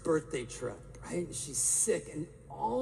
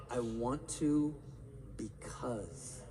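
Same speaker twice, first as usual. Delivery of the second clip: whispered mantra; 4 semitones lower, 5 dB quieter, much slower than usual. Shown as a demonstration. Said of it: "Write that down".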